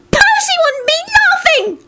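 tiny, very high angry voice; wrathful squeak. A narrator's reading. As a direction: squeak it out in a temper. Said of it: "Arthur squeaked wrathfully".

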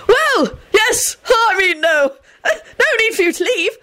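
high, overdone joviality; false conviviality. A narrator's reading. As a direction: boom out forced friendliness in a high voice. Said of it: "boomed out in a voice of false conviviality".

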